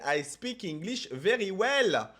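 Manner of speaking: slow, loud English with a French accent. Shown as a demonstration. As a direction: speak English slowly in a thick French accent. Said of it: "parler avec l'accent français".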